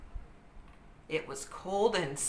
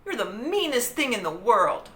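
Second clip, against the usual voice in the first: loud, higher angry scold; 5 semitones higher, 7 dB louder than usual. A narrator's reading. Annie yells at the monkey, angry. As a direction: tell the monkey off hard.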